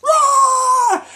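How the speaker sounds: high, loud mock battle yell; high shouted rah like a charging hero; battle cry. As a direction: let out a shrill anime power-up cry.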